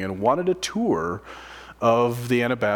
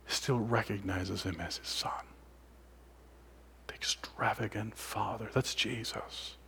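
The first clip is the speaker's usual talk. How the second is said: whispered, much softer, reverent; hushed awe; 14 dB quieter than usual. Real, not acted. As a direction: let it sink in with a hush. Preacher drops to a hush.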